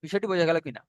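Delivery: ordinary talk, nothing marked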